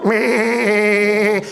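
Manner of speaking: bleating sheep. Shown as a demonstration. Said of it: "Brenda's the sheep".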